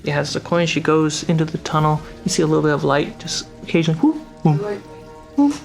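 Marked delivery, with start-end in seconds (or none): none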